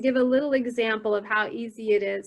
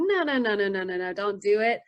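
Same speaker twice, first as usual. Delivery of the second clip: rapid string of no's; mock urgent self-warning; inner panicked protest; 3 semitones lower than usual. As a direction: run the words together in protest. Frantic inner protest while paying.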